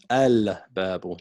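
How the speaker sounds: al-babu with a clear l, not assimilated; al-babu, l sounded, not abba; sun letter assimilation. Shown as a demonstration. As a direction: say al-babu, keeping the l in al. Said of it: "not abba".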